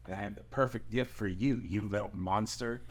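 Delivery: low soft playful threat; mock menace. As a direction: tease the boy with a quiet menace. Dragon mock threat to boy.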